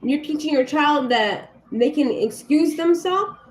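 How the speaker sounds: angry mother telling off another parent; furious confrontation. Retold or imitated. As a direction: tear into the parent with outrage. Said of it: "I said".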